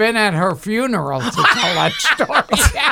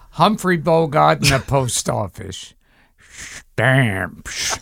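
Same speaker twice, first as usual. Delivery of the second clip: Humphrey Bogart impression; 6 semitones lower, slower than usual. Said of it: "Carol Brady imitating Gilbert. Imitating Humphrey Bogart".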